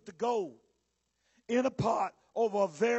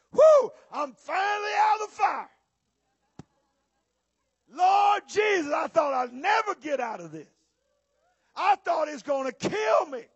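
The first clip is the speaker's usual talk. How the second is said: high, loud cry of deliverance; shouted relief; 5 semitones higher, 5 dB louder than usual. Acted. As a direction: shout it like you barely survived. Acted relief at leaving the fire.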